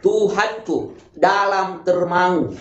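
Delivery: slow, careful enunciation of "Tuhanku. Dalam termangu"; pengucapan jelas. Shown as a demonstration. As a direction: recite "Tuhanku. Dalam termangu" with every syllable drawn out and distinct. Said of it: "Itu harus jelas pengucapannya". (baca "Tuhanku. Dalam termangu" lambat dengan lafal jelas)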